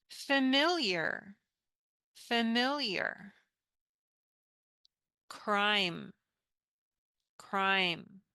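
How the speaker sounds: slow, clear repeat-after-me words; careful English teacher modelling; model pronunciation. Shown as a demonstration. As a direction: model each word twice for students. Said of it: "we pronounce this".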